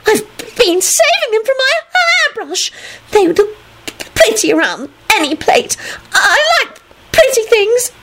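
very high, tearful whining; pathetic. A narrator's reading. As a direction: whimper it with hammed-up self-pity. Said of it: "she added pathetically".

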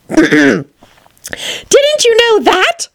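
very high, drawn-out squeak; crying-out daisy. Acted. Second daisy chiming in, high squeal. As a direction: squeal it as another tiny flower. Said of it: "cried another daisy".

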